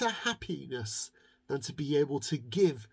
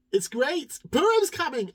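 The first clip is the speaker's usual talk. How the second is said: higher, louder joyful exclaim; excited cheer; 9 semitones higher, 7 dB louder than usual. Acted. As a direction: shout it with glee. Child cheers Purim arrival.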